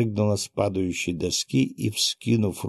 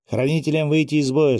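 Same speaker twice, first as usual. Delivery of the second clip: raised-pitch battle order; sharp command; 6 semitones higher, 4 dB louder than usual. A narrator's reading. Genzo orders guardians out of battle, commanding. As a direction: order the troops out with authority. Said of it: "скомандовал Гензо".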